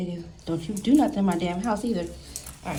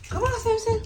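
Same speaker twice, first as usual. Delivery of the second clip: dog talk; 13 semitones higher than usual. Real, not acted.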